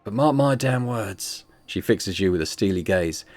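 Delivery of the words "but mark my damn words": slow, grave warning; drawn out, leaning on each word; slow and emphatic. Acted. Retake of false start.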